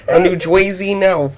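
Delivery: high, exaggerated imitation of Chicago accent; mocking Chicago accent. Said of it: "This is Chicago accent".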